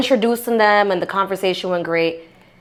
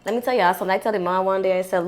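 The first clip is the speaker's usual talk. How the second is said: usual speaking voice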